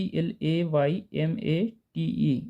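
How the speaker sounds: slow, letter by letter spelling. Shown as a demonstration. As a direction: spell each letter out slowly for the class. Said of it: "इसको क्या बोलेंगे?".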